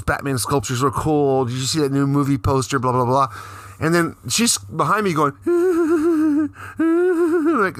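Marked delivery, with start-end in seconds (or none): 5.4-6.7 high-pitched mock sobbing; hammed-up high crying noise; imitated crying. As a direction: wail in a quavering mock cry. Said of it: "like, crying or whatever".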